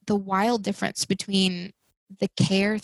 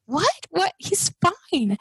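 higher, indignant protest at the nurses; mad disbelief; 10 semitones higher, much slower than usual. Retold or imitated. Mother protesting to nurses that her newborn is fine, indignant. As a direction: protest that he's fine, half-mock outrage. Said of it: "I remember being so mad".

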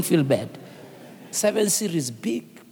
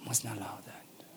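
soft, breathy whisper, much softer; 8 semitones lower, 16 dB quieter than usual. Real, not acted. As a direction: drop to a secret whisper. Whispered aside in sermon.

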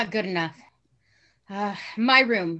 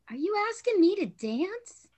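dazed, drifting words; woozy from the wound; in shock; 8 semitones higher than usual. Acted. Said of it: "She's going into shock".

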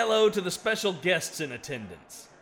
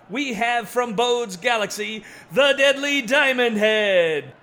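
ring announcer; 4 semitones higher, 6 dB louder, slower than usual. Acted. Ring announcer introduces fighter.

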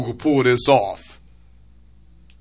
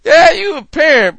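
usual speaking voice

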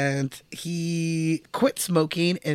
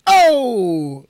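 Dice Clay catchphrase; 5 semitones higher, 8 dB louder than usual. Retold or imitated. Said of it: "his catchphrase would be like".

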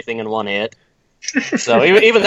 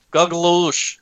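put-on Russian accent; 4 semitones higher than usual. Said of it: "say it in the Russian accent".

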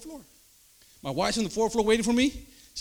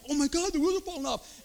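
mock panic; 8 semitones higher than usual. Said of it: "she starts".

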